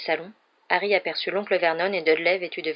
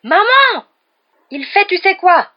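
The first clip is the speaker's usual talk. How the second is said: even higher, louder drawn-out shriek, slow; panicked scream; 13 semitones higher, 13 dB louder, slower than usual. A narrator's reading. Dudley running to his mother, wailing scream. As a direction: wail it as you run away. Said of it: "hurla Dudley".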